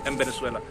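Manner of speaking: एम said as em, v turning to b in वेनेजुएला; m before v. Shown as a demonstration. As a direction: close the lips on एम. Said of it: "हल्का सा मैं".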